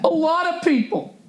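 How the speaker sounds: high, mocking sing-song. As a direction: parrot it back in a silly voice. Mocking the ad copy.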